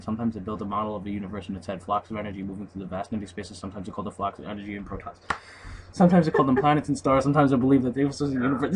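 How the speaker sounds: fast, hurried reading. Real, not acted. Gamer chases the speeding credits text, fast. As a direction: rush to keep up with the scrolling text. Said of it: "Is this getting faster?".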